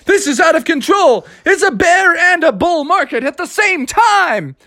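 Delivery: high-pitched, frantic outcry; panicked crowd. Acted. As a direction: cry out as things spiral out of control. Crowd panics at animal fight.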